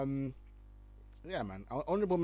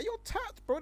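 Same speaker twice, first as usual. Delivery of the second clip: scoffing. Real, not acted. Scoffing at the tweet.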